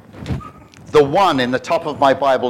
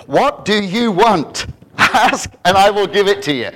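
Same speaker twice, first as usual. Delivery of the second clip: slow, higher, louder divine offer; God asking Solomon what he wants; 5 semitones higher, 5 dB louder than usual. Acted. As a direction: boom it out as God, generous. God's voice in sermon retelling.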